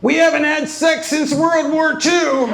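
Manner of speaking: high, put-on mom voice; hammed-up mom zinging dad; mom's one-liners. Mom mocking dad to the waitresses, in a high put-on voice. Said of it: "spouting off one-liners".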